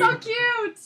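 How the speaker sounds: high, loud delighted squeal; gushing delight. Real, not acted. To friends about the dressed-up little girls, gushing squeal. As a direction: squeal it as if melting.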